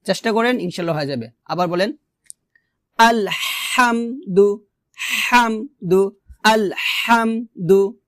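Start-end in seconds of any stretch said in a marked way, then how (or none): 3.0-8.0 আলহামদু slowly, ح squeezed from mid-throat; হামদু with a pharyngeal h; pressed thin ha. Shown as a demonstration. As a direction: model আলহামদু with a tight, thin হা. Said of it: "ঠিক এভাবে আপনাকে উচ্চারণ করতে হবে".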